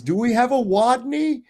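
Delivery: high, lisping Roman governor who turns R into W; mock a squeaky governor who can't say his R's; lisping Pilate. Pilate asks the mocking crowd for Rodney, in a high lisping voice. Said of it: "he's got a speech impediment".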